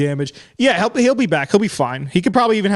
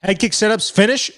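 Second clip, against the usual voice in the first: usual speaking voice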